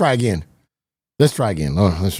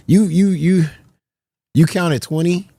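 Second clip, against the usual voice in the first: teasing disbelief; 8 semitones higher, 4 dB louder than usual. Real, not acted. Teasing chatter's count.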